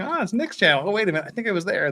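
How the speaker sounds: high, surprised double take; mock realising it's someone else on screen; lightly acted confusion; retold surprise. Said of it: "I was just going".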